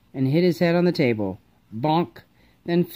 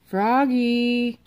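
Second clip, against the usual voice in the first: calling out; 6 semitones higher than usual. Acted. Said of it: "called his mom".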